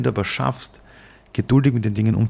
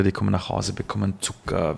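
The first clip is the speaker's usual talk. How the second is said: usual speaking voice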